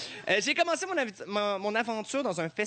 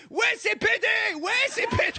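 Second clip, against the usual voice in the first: high, slow mocking shout of a Paris heckler; heckler yell; 6 dB louder, slower than usual. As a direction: bellow it like a rowdy heckler. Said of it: "ça criait".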